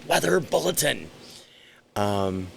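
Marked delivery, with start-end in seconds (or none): 0.1-1.1 high, booming mock TV alert; giant-letter announcement. Describing the TV's terrifying weather slide, mock-dramatic announcement. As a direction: read it out like huge scary on-screen letters. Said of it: "and then giant letters".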